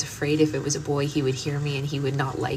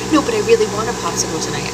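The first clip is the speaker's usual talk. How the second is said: put-on whiny child; 4 semitones higher, 6 dB louder, faster than usual. Voicing her whining daughter.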